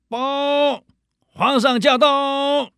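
high, drawn-out herald shout; shrill palace servant. Retold or imitated. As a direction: cry it out like a court herald. Second soldier announces.

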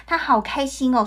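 ordinary delivery

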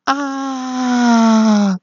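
long high wailing cry of pain; miserable scream. Acted. The big monster getting hit, long high scream of pain. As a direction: let out one long high shriek. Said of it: "突然听到一声惨叫".

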